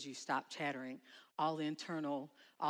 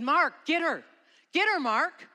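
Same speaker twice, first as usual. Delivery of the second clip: high, loud desperate call; frantic shout; 11 semitones higher, 13 dB louder than usual. Retold or imitated. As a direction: yell across dark water to reach him. Retelling frantic call in water.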